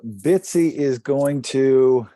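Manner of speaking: ordinary delivery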